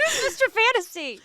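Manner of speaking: high, laughing question; baffled, laughing ask; confused surprise. Retold or imitated. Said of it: "and I was like".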